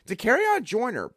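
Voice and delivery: usual speaking voice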